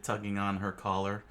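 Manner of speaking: plain reading voice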